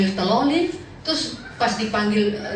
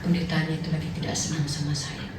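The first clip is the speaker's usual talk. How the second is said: low mock grumble; 8 semitones lower, 9 dB quieter than usual. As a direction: lower and softer, half laughing. Offended member's inner grumble. (gumam rendah pura-pura tersinggung)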